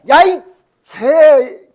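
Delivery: put-on very loud, high shout at a child; angry outburst at kid. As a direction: blurt a curse at a naughty pupil. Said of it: "개까지 나왔어". (말 안 듣는 아이에게 버럭 지르는 고함)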